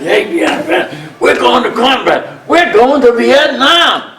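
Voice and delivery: put-on high, eager call of a young officer; excited lieutenant. Lieutenant telling the crew they're off to combat, excited and high. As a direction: pitch it way up like a thrilled lieutenant. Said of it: "he's all excited".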